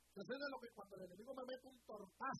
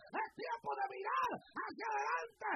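louder preaching call; 8 semitones higher, 11 dB louder than usual. Acted. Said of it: "repeated sermon line".

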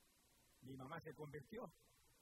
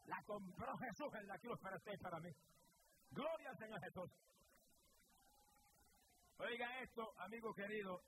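raised, ringing sermon voice; fervent preaching; 6 semitones higher, 3 dB louder than usual. Acted. Preacher urging the listeners to accept Christ, fervent preaching. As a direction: preach it out to the whole church.

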